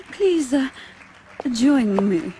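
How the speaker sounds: ordinary delivery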